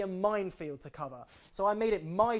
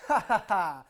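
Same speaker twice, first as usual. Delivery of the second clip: fake laugh; 8 dB louder than usual. Forced laugh at a joke.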